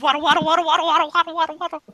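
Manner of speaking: high, bouncy singsong repetition; waddling duck run. Acted. Game master showing players how she runs, waddling duck chant. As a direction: chant it like little duck steps, high-pitched. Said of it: "when a duck runs".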